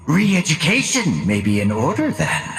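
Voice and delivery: ordinary talk, nothing marked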